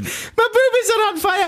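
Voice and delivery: squealing falsetto Scottish nanny; hammed-up, hysterical high impression; Mrs Doubtfire. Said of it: "Robin Williams in Missus Doubtfire".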